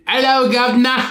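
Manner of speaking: hammed-up British greeting; cartoonish British voice; mock British accent. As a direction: put on a cheeky British accent. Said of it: "do your British accent for this one".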